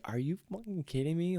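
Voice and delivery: high exasperated outburst, lightly imitating the buddy. Buddy snapping from laughter to exasperation. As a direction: snap from laughing to disbelief. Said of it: "flicks of a switch".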